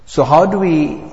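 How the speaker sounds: ordinary talk, nothing marked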